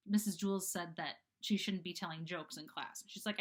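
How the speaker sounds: ordinary delivery, nothing marked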